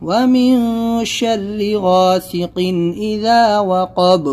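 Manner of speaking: slow, melodic tajweed recitation; Quran recitation. Shown as a demonstration. Teacher recites Surah Al-Falaq slowly, melodic tajweed. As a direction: chant each verse slowly and musically.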